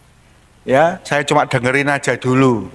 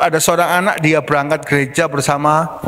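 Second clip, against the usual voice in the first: ordinary talk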